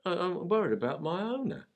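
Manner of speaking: a young London pup voicing his worry; anxious puppy in a London accent. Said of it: "use a Cockney accent".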